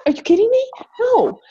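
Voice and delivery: high-pitched indignant disbelief; outraged disbelief. Real, not acted. Outraged at boss's reply.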